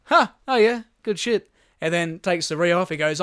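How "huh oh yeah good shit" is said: high, loud mock confusion; baffled reply. Mimicking the crew answering the driver, loud and bewildered. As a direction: fake polite agreement while lost.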